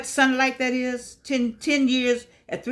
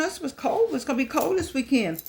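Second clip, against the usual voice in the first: ordinary talk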